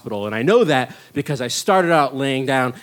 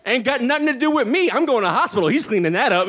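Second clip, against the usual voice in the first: high, laughing brush-off; acted giggly glee, pitched up; gleeful disowning; 8 semitones higher, faster than usual. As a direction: wash your hands of it, amused. Gleefully disowns the mess to audience.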